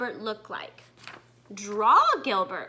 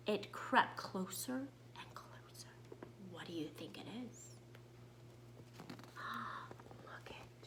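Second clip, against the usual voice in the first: hushed whisper, much softer; suspense whisper; 6 semitones lower, 21 dB quieter than usual. Acted. Teacher asking children about the shadow, whispering. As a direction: lean in and whisper to build dread.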